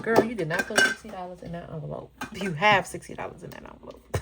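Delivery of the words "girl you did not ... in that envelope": loud scolding viewer voice; mock yell. Viewer scolding her about the envelope, mock yelling. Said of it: "y'all are yelling at me like".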